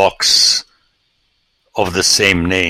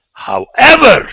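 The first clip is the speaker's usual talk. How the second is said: much higher, loud pivot word; dramatic however; 7 dB louder than usual. Real, not acted. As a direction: throw in "However" as a big turn. Dramatic turn in argument.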